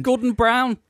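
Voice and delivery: loud, high indignant name-call; mock outrage. Shown as a demonstration. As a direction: spit out "Gordon Brown" as an accusation. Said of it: "have to say it like that".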